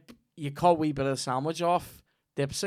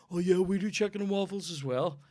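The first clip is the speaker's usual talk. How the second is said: higher sneering mock voice of a rival café; mock rival café boast; 7 semitones higher, 3 dB quieter than usual. Host mocking rival cafés bragging about waffles, in a higher sneering voice. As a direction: parody a smug rival café owner. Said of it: "People going".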